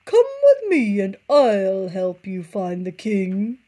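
slow, sly luring fox voice. A narrator's reading. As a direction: coax them in.

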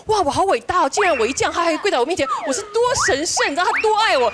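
得意惊叹. Retold or imitated. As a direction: gush as if flattered by someone's devotion. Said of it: "想说，哇". (夸张地自我陶醉)

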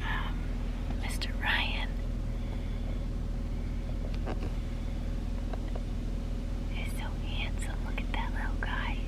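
whisper, don't wake her. Real, not acted. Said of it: "I have to whisper because Freya's going to sleep".

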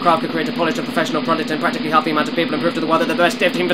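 rattled off very fast; rapid-fire. Real, not acted. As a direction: race through it without breathing. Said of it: "I am so out of breath".

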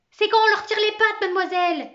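réponse criée, aiguë et forte; cri d'élève. Acted. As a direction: crie la réponse depuis ta place. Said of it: "cria Martin". (réponse d'élève lancée en criant)

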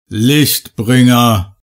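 drawn-out thunderous idol calling out; thundering idol voice. A narrator's reading. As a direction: boom the name out slowly like a stone deity. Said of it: "donnerte sie so laut".

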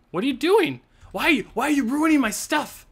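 high, indignant outcry; indignant protest. Real, not acted. Gamer catching co-player ruining the build, indignant protest. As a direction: protest sharply, pitch jumping up.

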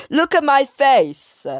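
high, loud mock yell; hammed-up shouted demand; all caps shout. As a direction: yell it in the character's face. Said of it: "in all caps".

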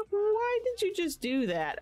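high squealed question; amused disbelief. Real, not acted. Asking the game character why she did that, high amused squeal. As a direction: squeal it, on the edge of laughter.

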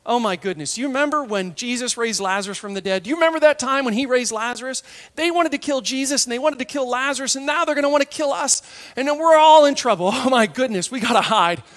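fast, rising panicky babble; acted frantic alarm among friends in hiding; fearful. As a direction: build from worry to loud panic.

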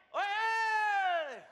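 long, high, loud holler; triumphant yell. Acted. Crew cheering as the balloon flies away, in a huge sustained shout. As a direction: let out a drawn-out cheer.

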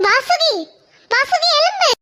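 high, frantic cries of a mother calling her daughter's name; panicked calling out. Acted. Mother calls her fainted daughter Vasuki, panicked and high. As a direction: call out to her fainted child in alarm.

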